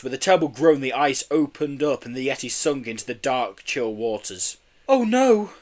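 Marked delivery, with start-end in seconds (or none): 4.9-5.5 sobbing. A narrator's reading. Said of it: "sobbed Missus Kelly".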